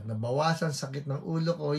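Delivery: ordinary talk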